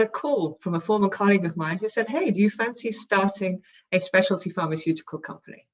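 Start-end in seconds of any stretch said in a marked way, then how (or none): none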